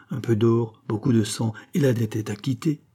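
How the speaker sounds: ordinary delivery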